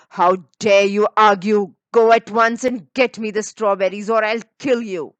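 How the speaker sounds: loud furious order; angry threat. Acted. Cruel sister threatening Marukla, loud and furious.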